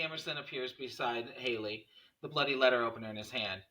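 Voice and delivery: usual speaking voice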